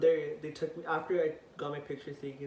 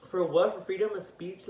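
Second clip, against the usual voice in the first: loud, higher retold challenge, easing off; indignant protest; 4 semitones higher, 8 dB louder than usual. Teen answering cop's threat of juvenile facility, indignant. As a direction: fire back in disbelief.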